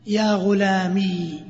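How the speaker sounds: يا غلامي with the final ي held long and silent; ya ghulami. Shown as a demonstration. Teacher demonstrating the first form to students, slow and deliberate. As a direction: draw out غلامي, ending on a still long ي. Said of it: "بإثبات الياء ساكنة".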